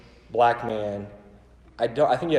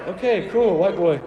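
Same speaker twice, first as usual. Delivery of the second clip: playful joke; 6 semitones higher, 6 dB louder than usual. Retold or imitated. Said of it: "And I was like".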